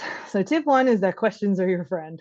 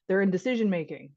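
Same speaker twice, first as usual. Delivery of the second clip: normal talking voice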